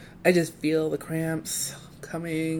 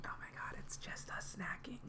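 whispering; 22 dB quieter than usual. Pretend. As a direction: giggly whisper. Whispered ASMR joke.

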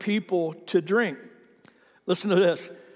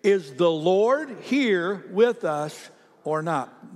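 slow group reading; much slower than usual. Shown as a demonstration. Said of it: "Read it again".

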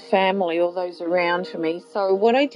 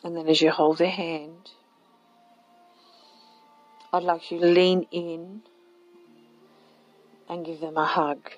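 guided meditation. Acted. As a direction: gently lead them inward. Leads a guided visualization.

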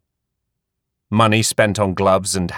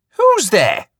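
high, insistent question; demanding; 12 semitones higher, 4 dB louder than usual. A narrator's reading. Queenie demanding to know who is outside. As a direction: demand an answer. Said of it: "Queenie demanded".

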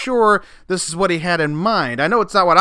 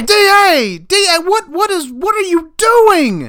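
shocked shout; 5 dB louder than usual. Acted. Host shocked at D A yelling.

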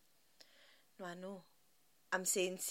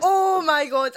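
loud, high, helpless laughing wail; laughing mortification; 7 semitones higher, 14 dB louder, slower than usual. Real, not acted. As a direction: cry it out through embarrassed laughter.

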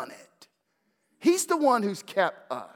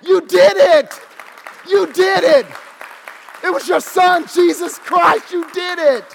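loud high shouts giving God credit; praising shout; 12 semitones higher, 13 dB louder than usual. Pretend. Believers crediting God for salvation, loud high shouting. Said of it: "we go".

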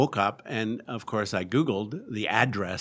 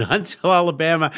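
loud, high, fast disbelieving echo; astonished; 8 semitones higher, 10 dB louder, faster than usual. Retold or imitated. Caller learning the shop is in Alabama, astonished. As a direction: blurt it back in disbelief. Caller discovers he booked wrong town.

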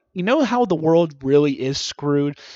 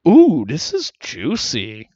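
mock gossip; 2 semitones lower, 5 dB louder, slower than usual.

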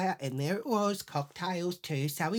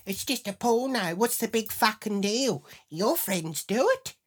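higher-pitched, protesting voice; exasperated defence; 6 semitones higher, 4 dB louder than usual. Retold or imitated. Husband defending his tapes to his wife, in high exasperation. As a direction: argue it back, pitched up and impatient.

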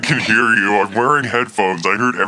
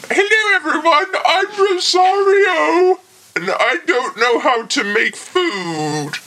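much higher, slow mock British voice, half shouted; mocking impression; slower than usual. Mocking how his friend talks, in a squealing British accent. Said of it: "look, hang on".

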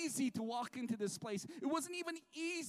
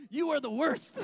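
a louder jab, half joking; mock retort; 4 semitones higher, 7 dB louder than usual. Preacher mocking a friend who always complains, with a mock retort. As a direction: snap back at the complainer in jest.

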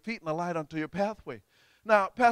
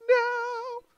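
high, sustained choir-like note; alto part; 4 dB louder than usual. Shown as a demonstration. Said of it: "Let's hear the alto say it now".

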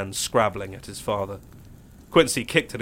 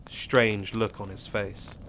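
ordinary delivery

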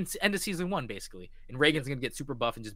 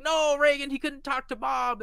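much higher upset voice; high dismayed cry; 12 semitones higher, 4 dB louder than usual. Retold or imitated. Voicing his own dismay.

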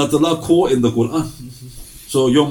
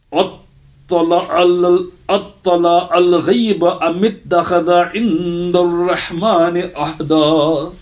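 brisk chanted Quran recitation, a little higher; 4 semitones higher, much faster than usual. Acted. Preacher recites the verse to the congregation, chanted. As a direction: intone the verse in Arabic recitation style.

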